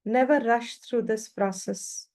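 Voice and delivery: ordinary delivery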